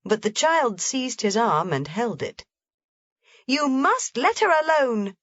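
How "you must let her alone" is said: put-on high commanding child's cry; cried out. A narrator's reading. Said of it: "she cried".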